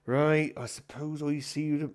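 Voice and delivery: wrong character voice. Acted. Landlord bidding the comedian goodbye, in a mistaken voice. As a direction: start in a voice that isn't his. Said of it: "he doesn't speak like that".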